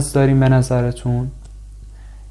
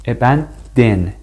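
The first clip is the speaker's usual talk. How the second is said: ابن دن said slowly, each syllable apart; slow syllable-by-syllable demo of ابن دن; split into syllables; slower than usual. Shown as a demonstration. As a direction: space out ابن دن like a teacher.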